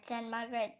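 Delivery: ordinary delivery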